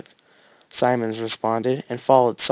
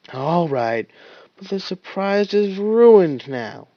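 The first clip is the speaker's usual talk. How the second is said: warning; 6 semitones higher, 4 dB louder, slower than usual. Acted. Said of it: "he warned".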